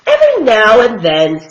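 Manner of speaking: usual speaking voice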